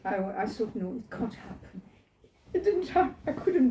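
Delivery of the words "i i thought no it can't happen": ordinary talk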